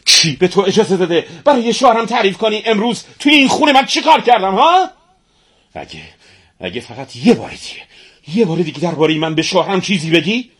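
high, loud angry scolding; indignant high-pitched telling-off. A narrator's reading. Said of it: "با عصبانیت به اون گفت".

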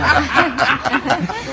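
a high real laugh. Real, not acted. Storyteller laughing at his own punchline. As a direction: let the laugh bubble out.

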